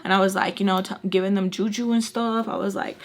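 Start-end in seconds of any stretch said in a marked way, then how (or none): none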